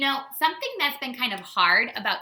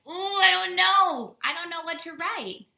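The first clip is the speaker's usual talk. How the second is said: teacher imitating herself stuck for ideas, whiny and higher; 9 semitones higher than usual. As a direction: moan like a stuck writer. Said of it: "I'm like".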